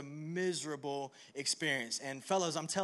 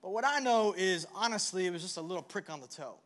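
usual speaking voice

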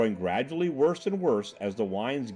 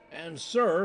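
screaming in his ear; 6 semitones higher than usual. Acted. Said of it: "screaming in his ear".

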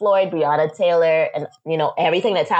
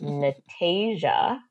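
name drawn out slowly and softer; mom's warning; 5 dB quieter, much slower than usual. Retold or imitated. Mother telling her daughter to give in, warning. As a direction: stretch the name out as a quiet parental warning. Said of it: "one of those looks that your mom gives you".

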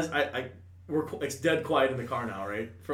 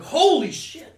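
surprised; 13 semitones higher, 9 dB louder, slower than usual. Retold or imitated. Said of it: "I was like".

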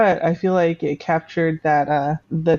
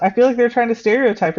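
laughing; 6 semitones higher than usual. Real, not acted. Laughing while mocking movie.

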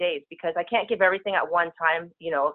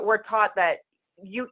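ordinary talk, nothing marked

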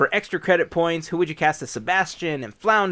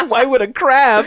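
laughing; 10 semitones higher, 4 dB louder than usual. Real, not acted. Laughing at lobster singing.

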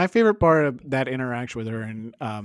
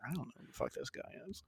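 whispered, much softer, quick aside; mock whispered thought; 20 dB quieter, faster than usual. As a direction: mutter it under your breath, baffled. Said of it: "It's like".

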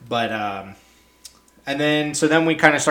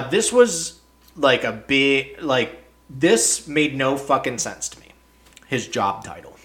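usual speaking voice

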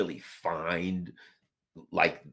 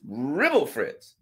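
confused mumble; 6 semitones higher, 4 dB louder, much slower than usual. Retold or imitated. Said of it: "So they're gonna say".

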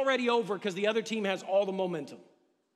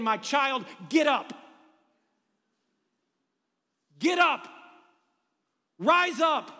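louder and higher, shouting get up; shouted command; 3 semitones higher, 4 dB louder, slower than usual. Acted. Jesus shouting to the defeated church to rise, full force. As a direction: call them up off the mat with a shout.

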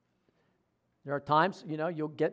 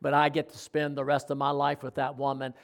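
retold tearful groom; 6 semitones lower, 6 dB quieter than usual. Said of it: "he's crying".

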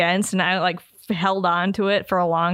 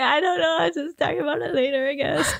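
mock teary giving up; 7 semitones higher than usual. Retelling crying in argument.

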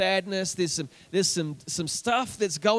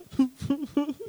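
high, small hammed-up sobs; mock sobbing; 6 semitones higher than usual. Shown as a demonstration. Preacher imitating the sobber in the corner, mock sobbing. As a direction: sob in little bursts like someone in the corner. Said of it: "there's the sobber in the corner".

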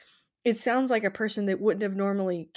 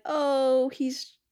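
higher-pitched imitation of a wife's excuse; mock wifely excuse; 5 semitones higher, much slower than usual. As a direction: play the wife lightly, covering for him. Wife excusing him to guests.

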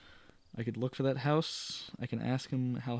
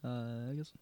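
normal talking voice

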